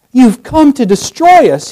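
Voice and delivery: high, loud cry, falling on destroy; fearful declaration. Acted. Demon cries out to Jesus, fearful. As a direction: declare your doom in dread. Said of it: "he declares".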